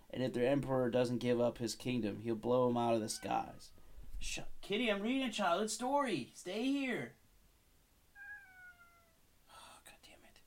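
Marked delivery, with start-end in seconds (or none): 4.2-7.1 much higher, sing-song voice to the cat. Real, not acted. Talking to cat mid-reading. 9.5-10.4 breathless whispered swear under the breath; whispered curse. Real, not acted. Cat interrupts reading.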